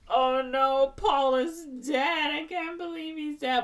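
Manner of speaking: high, drawn-out mock wail; hammed-up grief, pitched high; mock dismay. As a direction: lament it with fake melodrama. Retelling group's grief, melodramatic.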